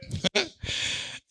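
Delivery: short unvoiced laugh, high and soft; breathy high laugh. Real, not acted. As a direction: let a quick airy laugh escape. Preacher laughs after contrast. (risada curta e soprada)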